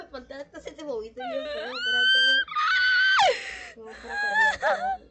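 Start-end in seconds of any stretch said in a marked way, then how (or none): 1.2-5.0 high shaky shouting after a scare; panicked shouting. Real, not acted. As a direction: shout it out, still terrified. Complaining about the scare.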